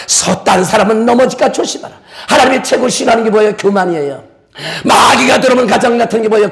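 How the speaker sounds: ordinary talk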